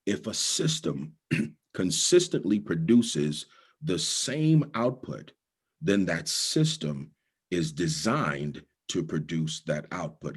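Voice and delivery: slow, each word weighed; slow it right down so it sinks in; deliberate, unhurried restatement. Shown as a demonstration. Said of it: "I'm gonna say it again, slower".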